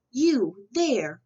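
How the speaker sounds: loud, grumpy call-out; grousing. A narrator's reading. Cranky mayor scolds the rooster from his window, loud grumbling. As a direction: bark at the noisy bird. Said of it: "groused Don Pepe".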